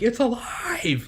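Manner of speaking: high, drawn-out cry of creation; triumphant creator. Acted. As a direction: crow it slowly and high like a mad inventor. Excited mad-scientist quote, sending proof picture.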